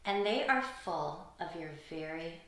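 usual speaking voice

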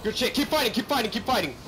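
high, loud hype shout; excited cheer. Real, not acted. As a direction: pump up the team. Cheering team mid-fight.

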